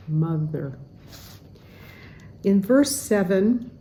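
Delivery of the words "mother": "mother" with a clear voiced th, tongue between the teeth; voiced th demo. Shown as a demonstration. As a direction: model "mother" slowly, no D sound. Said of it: "instead of saying mudder with a D sound".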